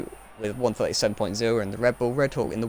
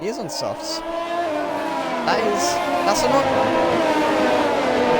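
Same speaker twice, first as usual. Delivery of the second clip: high, slow and surprised; 14 semitones higher, 3 dB louder, much slower than usual. Real, not acted. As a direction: drag the words out in disbelief. Surprised that the driver is on soft tyres.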